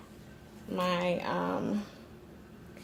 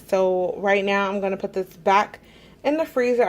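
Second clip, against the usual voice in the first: ordinary talk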